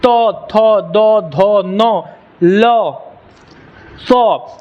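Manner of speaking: slow तो, थो, दो, धो with tongue on teeth; evenly sustained तो, थो, दो, धो, नो, लो, सो; dental sound demo. Shown as a demonstration. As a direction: hold each of तो, थो, दो, धो, then a separate सो. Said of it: "जीभ कहाँ आ रहा है".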